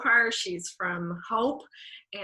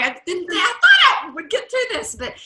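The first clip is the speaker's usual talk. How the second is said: trying to get through it while starting to cry; emotional, on the verge of crying for real; fighting back tears; 10 semitones higher, 14 dB louder than usual. Real, not acted. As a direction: voice cracking as real tears come, louder and higher. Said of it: "Sorry, I'm gonna cry".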